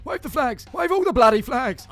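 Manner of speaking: excited cheer. Acted. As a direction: rouse the crowd. Celebrating a goal, urging fans.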